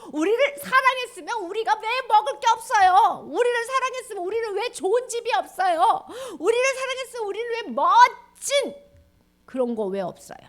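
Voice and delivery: high, loud whining protest; angry complaint. Acted. People angrily complaining to God, whining protest. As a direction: complain bitterly. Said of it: "화가 나가지고".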